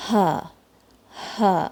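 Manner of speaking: ہا with breath flowing out like a sigh; breathy ha. Shown as a demonstration. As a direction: let the air run through ہا. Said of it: "the next sound is".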